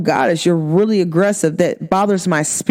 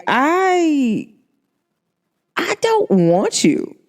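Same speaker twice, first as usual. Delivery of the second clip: emphatic rejection; 8 semitones higher, much slower than usual. Retold or imitated. Retells turning down a male friend.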